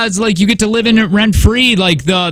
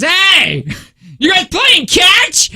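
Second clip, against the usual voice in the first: calling out; 11 semitones higher, much slower than usual. Retold or imitated. Kid calling across yards.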